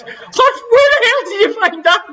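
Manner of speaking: very high, laughing incredulity; laughing disbelief. Real, not acted. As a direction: squeal it through real laughter. Cracking up at the accent attempt.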